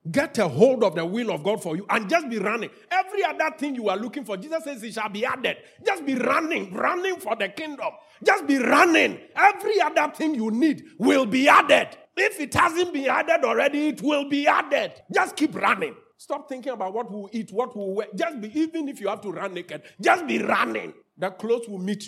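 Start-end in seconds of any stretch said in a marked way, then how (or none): 5.9-16.0 high, loud, driving preacher's cry; fiery preaching shout. Real, not acted. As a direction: hammer the call to keep running. Preacher urging congregation to run.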